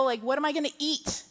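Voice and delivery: ordinary delivery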